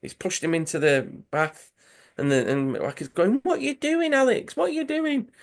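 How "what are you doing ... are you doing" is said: high, shrill-pitched alarmed cry; shocked yelling. Retold or imitated. Manager shrieking at the player who pushed the big man in. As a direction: shriek it in mock shock at the pushing.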